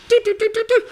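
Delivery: high beeping "do do do"; quick run of high sung beeps; imitated phone dialing. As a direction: mimic phone keypad tones.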